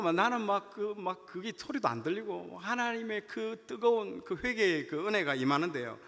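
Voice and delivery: ordinary talk, nothing marked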